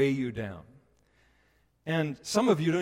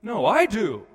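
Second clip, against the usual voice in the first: louder, higher, jostling; squabbling claim; 6 semitones higher, 7 dB louder than usual. Retold or imitated. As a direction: push to the front arguing. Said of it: "elbowed each other".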